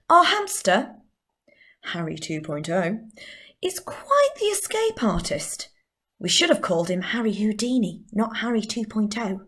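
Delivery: swooping pitch, quizzical in the middle; expressive monologue. Acted. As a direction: deliver it with theatrical pauses. Performs her monologue.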